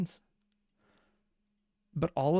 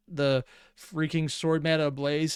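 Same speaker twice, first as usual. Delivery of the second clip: usual speaking voice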